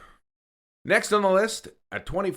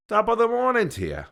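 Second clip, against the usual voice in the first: mock Irish accent; 8 semitones higher, 4 dB louder than usual. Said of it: "Next on our list is Ireland".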